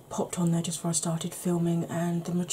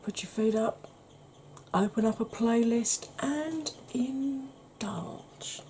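ASMR whisper; 4 semitones higher, 4 dB quieter, slower than usual. Acted. Whispered invitation.

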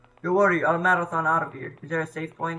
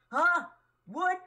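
high squeak of confusion; baffled, pitch shooting up; confused; 12 semitones higher than usual. Real, not acted. Confused by the riddle.

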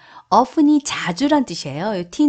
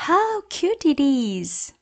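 higher, very slow coo; gushing exclamation; 6 semitones higher, much slower than usual. Shown as a demonstration. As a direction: gush over something cute. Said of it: "그거 너무 귀엽다". (높게 천천히 감탄하며)

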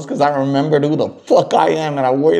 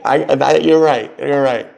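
usual speaking voice

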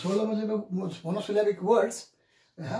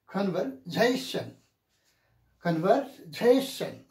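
slow "Conversation", main weight on "-sa-"; primary and secondary stress; slower than usual. Shown as a demonstration. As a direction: model "Conversation" as con-ver-SA-tion. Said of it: "we should say".

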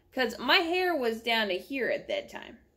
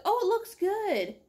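mock reassuring stylist; 10 semitones higher than usual. Stylist quoted reassuring slowly.

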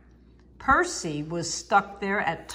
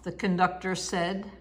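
plain reading voice

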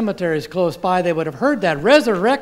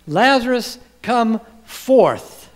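raised, measured, commanding call; loud command; 5 semitones higher, 4 dB louder, much slower than usual. Acted. Preacher quoting Jesus calling Lazarus from the tomb, loud command. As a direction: call it out slowly to a crowd. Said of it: "in a loud voice".

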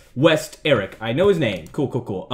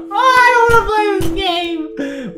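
high, drawn-out wail of protest; scared whining; 14 semitones higher than usual. Real, not acted. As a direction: whine it out, stretched and high. Scared player protests the game.

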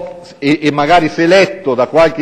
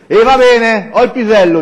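higher, louder exasperated concession; fed-up; 6 semitones higher, 5 dB louder than usual. A narrator's reading. Zaf answering his friends' objections, fed-up. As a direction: give in with raised-voice annoyance. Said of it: "Zaf si era proprio scocciato".

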